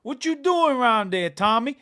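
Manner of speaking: high, loud Goodfellas-style Italian-American; Italian-American accent. Retold or imitated. Retelling cheesesteak-place old guys, mock Italian-American accent. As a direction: put on a shouty Philly Italian-American accent. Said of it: "talking like the, the Italian Americans in the film".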